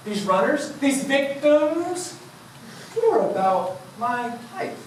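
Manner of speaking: ordinary delivery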